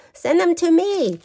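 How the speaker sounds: high, loud teasing plea; playful demand. Real, not acted. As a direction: beg a friend cheekily for her paper. Playful demand to friend Joanne.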